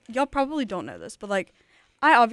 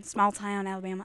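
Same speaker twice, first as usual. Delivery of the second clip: movie-style Alabama accent. Shown as a demonstration. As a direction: lay on a hammy Alabama twang. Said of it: "how the movies portray Alabama".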